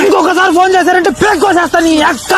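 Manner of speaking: shouted comic threat; angry threat. Acted. Man fed up with loan calls, shouting a threat. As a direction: snap the threat at the pest.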